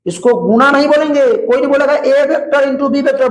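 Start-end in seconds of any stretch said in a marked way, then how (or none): 1.9-3.3 mock the wrong reading "ए vector into बी vector", higher; mocking wrong version. Shown as a demonstration. Teacher mocking the wrong way to read it, higher. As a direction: say it as the mistake he would punish. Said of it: "बड़ी मार मारेंगे".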